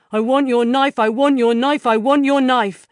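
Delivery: Martin's demand rising to a shout; escalating demand. A narrator's reading. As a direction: demand the knife, building to a shout.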